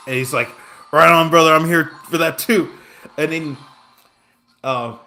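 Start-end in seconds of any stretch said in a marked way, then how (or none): 0.9-2.7 loud imitation of a raspy racetrack guy. Stranger hears they came for the same singer, loud imitated raspy voice. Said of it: "he has this raspy voice".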